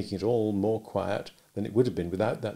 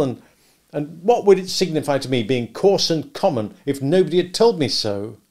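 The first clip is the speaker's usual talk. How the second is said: bitter complaint; 4 semitones higher, 7 dB louder than usual. A narrator's reading. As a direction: spit out his dissatisfaction louder. Pip voiced, loud.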